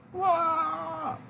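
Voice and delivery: hammed-up high-pitched princess wail. Princess wailing as the king silences her, in a high voice.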